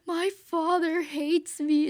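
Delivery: high, loud, slow hammed-up sob; mock crying Spock. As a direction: wail it like a weepy Spock. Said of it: "Spock's like".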